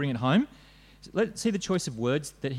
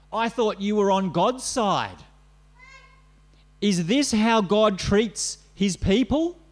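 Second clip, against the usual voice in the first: higher, louder jeering at a believer; mocking taunt; 6 semitones higher, 4 dB louder than usual. Enemies jeering at David for trusting God, mocking and loud. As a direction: sneer it like the crowd mocking him.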